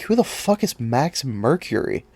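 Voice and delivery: baffled, half under the breath; asking the camera in disbelief; incredulous. Real, not acted. Vlogger puzzling over an unknown hero, incredulous. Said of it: "that small outburst".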